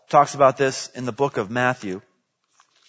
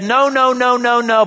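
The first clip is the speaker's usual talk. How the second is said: mock refusal; 7 semitones higher, 4 dB louder than usual.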